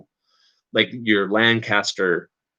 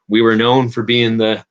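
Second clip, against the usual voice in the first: normal talking voice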